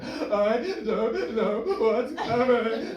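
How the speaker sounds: put-on high, loud, slow wailing like a sobbing child; mock childlike crying. Imitating the panicked friend sobbing like a child. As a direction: wail it like a crying kid. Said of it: "doing this thing that children sometimes do when they cry".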